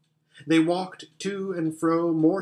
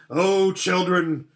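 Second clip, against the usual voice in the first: louder, slower, higher joyful call; playful lion call; 4 semitones higher, 5 dB louder, slower than usual. A narrator's reading. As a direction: call them to play, full of strength. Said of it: "said the lion".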